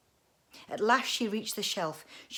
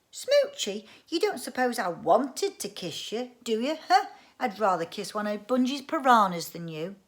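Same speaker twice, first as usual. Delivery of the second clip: scornful outrage; 3 semitones higher, 8 dB louder than usual. Acted. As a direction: sneer back in disgust. Girl mocks the word smoochy.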